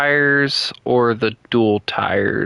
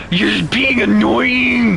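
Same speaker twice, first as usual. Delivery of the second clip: scolding parent; 9 semitones higher, 4 dB louder, much slower than usual. Pretend. Scolding a pretend child.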